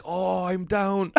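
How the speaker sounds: slow mock-sleepy reply; feigning drowsiness from bed; lazy drawl. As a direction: stretch it out like you just woke up. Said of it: "Just be like".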